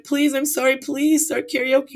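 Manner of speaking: mock tearful begging; whiny plea. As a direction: beg like the tearful girl. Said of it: "she's like".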